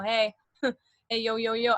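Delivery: playful "yo" voice with a laugh; lightly put-on cool-guy hello; casual hype greeting. Shown as a demonstration. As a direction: toss out a jokey street greeting. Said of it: "I'm still doing all of that".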